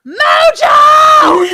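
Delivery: high, loud drawn-out holler; yelling. Retold or imitated. Calling Mojo back in for his song, yelling. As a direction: yell his name across the club. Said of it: "I'm yelling, like, three times".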